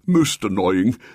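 high, chuckling pompous cleric; amused bishop. A narrator's reading. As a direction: explain it with a chuckle. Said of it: "said the bishop".

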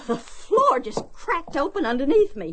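high, gasping shock after a fall; shaken. Acted. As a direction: gasp it out, stunned by the fall. Has just fallen through the floor.

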